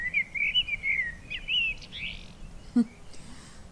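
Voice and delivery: high scornful laugh; mocking laugh. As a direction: laugh at the idle nobles. Mocking revelling nobles.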